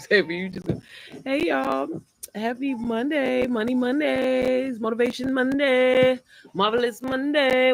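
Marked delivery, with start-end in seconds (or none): 1.2-7.7 drawn-out greeting. Real, not acted. Live stream greeting to viewers.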